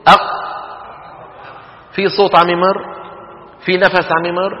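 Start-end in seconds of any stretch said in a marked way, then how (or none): 0.1-0.4 أق with the qaf fully closed, no breath or voice after; held qaf demo. Shown as a demonstration. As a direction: stop hard on the qaf of أق. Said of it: "قولوا مثلي". (أق بقاف شديدة محبوسة الصوت والنفس)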